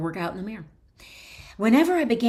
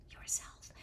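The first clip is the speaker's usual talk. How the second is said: whispered aside; 17 dB quieter than usual. Acted. Whispered aside inside sentence.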